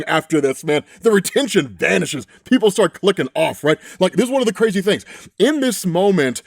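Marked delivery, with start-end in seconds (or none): none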